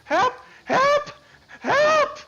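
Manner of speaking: loud, very high-pitched cries; piercingly high acted screams; girl shouting for help. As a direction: scream for rescue as a girl in danger. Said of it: "you hear the shout".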